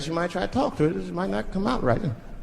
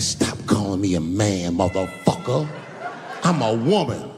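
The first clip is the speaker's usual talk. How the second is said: mock low retort; 8 semitones lower than usual. Woman correcting the comedian for calling her a man, lower indignant retort. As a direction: growl it back offended.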